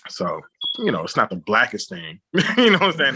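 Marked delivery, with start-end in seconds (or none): 2.3-3.1 high laughing aside. Real, not acted. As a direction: laugh through the words. Laughs at awkward remark.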